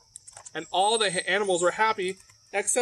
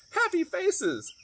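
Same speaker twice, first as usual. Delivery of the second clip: hen voice; 7 semitones higher than usual. Acted. Hen speaks in story.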